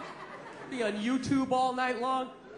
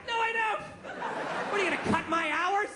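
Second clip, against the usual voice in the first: higher, cheeky laughing refusal; mock defiant; 7 semitones higher than usual. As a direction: taunt the guard playfully. Comic defying security guard.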